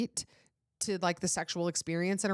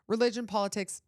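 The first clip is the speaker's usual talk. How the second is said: normal talking voice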